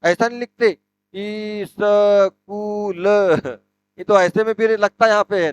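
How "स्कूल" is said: स्कूल stretched very slowly as səkul; slow teaching pronunciation of स्कूल; careful pronunciation. Shown as a demonstration. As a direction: draw स्कूल out slowly, syllable by syllable. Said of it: "इस ई मत समझना".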